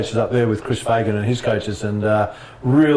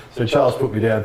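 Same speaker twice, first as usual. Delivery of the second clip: ordinary talk, nothing marked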